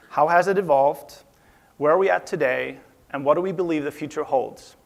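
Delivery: normal talking voice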